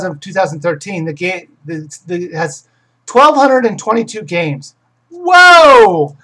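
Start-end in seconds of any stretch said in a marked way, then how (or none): 5.1-6.2 loud, high whoa; big surprised outburst; amazed exclamation. Real, not acted. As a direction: burst out in astonishment. Reacting to number of games found.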